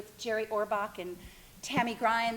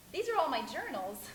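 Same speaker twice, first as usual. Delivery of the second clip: usual speaking voice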